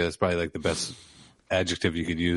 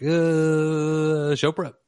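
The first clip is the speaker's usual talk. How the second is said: higher, louder mock dread; reluctant groan; 7 semitones higher, 3 dB louder, much slower than usual. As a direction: act out a groaning "oh right, work" moment. Acting out own sudden reluctant remembering.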